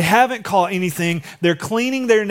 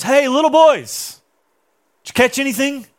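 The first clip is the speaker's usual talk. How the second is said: calling out; 7 semitones higher, 4 dB louder than usual. Acted. Jesus hailing fishermen in a boat.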